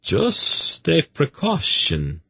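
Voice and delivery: high, drawn-out apology; meek, high-pitched character voice; apologetic. A narrator's reading. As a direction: excuse yourself meekly. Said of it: "he apologized".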